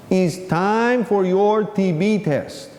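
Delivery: at half speed, each word drawn out; slowly repeated. Retold or imitated. Physician's assistant repeats himself to a baffled inmate, slowly. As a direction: spell it out slowly for someone who missed it.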